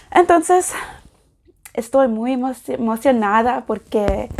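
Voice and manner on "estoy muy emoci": normal talking voice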